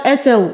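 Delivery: "أتوا" wrongly opened with an a on the hamza, "ataw"; فتح الهمزة خطأً. Shown as a demonstration. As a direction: say "أتوا" with an open first vowel, as a mistake. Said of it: "والهمزة مضمومة وليست مفتوحة". ("أتوا" بفتح الهمزة خطأً)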